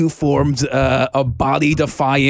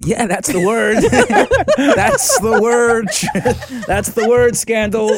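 laughing, higher and louder; 5 semitones higher, 3 dB louder, slower than usual. Real, not acted. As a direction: crack up between the words. Laughing at the joke.

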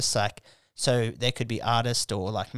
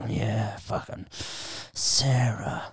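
slow, drawn-out fake moan; hammed-up groaning sleep-talk; mock groaning; 4 dB quieter, much slower than usual. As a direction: moan it lazily as if in your sleep. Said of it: "groaning and moaning".

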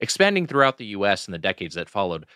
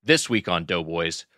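ordinary delivery

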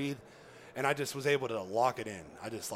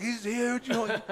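higher, laughing excitement; fired-up boast; 10 semitones higher, slower than usual. Retold or imitated. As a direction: brag about how huge he is. Guide fired up over the cat.

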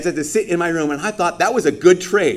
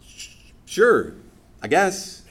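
hesitant stammered shrug of an answer; mock hesitant; 4 semitones higher, much slower than usual. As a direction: sound unconvinced, trailing off. Mock unsure answer to are you saved.

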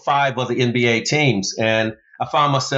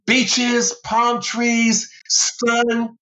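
high, eager list of beach dreams; picture paradise and light up; excited daydream; 10 semitones higher, much slower than usual. Real, not acted.

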